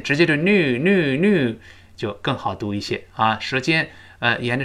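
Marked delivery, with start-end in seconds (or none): 0.4-1.6 American "new" without the Y glide, "noo"; American-style plain "noo" for "new". Shown as a demonstration. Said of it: "美国人觉得转圈儿费劲". (美式读法，"new"不带耶音)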